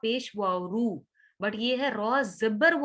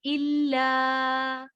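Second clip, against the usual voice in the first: slow, drawn-out tajweed recitation; Quran recitation; 3 semitones higher, much slower than usual. Shown as a demonstration. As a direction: recite it with ghunna on the noon. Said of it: "यहाँ पे शद है इसलिए ये इल्ला". (धीमी तजवीद वाली तिलावत)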